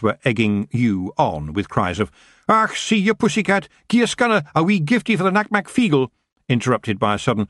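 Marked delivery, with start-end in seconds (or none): 2.5-6.1 high rough Scottish pixie; broad Scottish wee man; Scottish accent. Acted. Feagles egging on cat.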